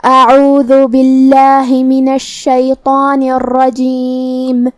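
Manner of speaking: slow melodic tajweed recitation; Quran recitation. Shown as a demonstration. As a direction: chant each verse slowly with held vowels. Full recitation of the passage. (قرآن کی آہستہ، سریلی تلاوت)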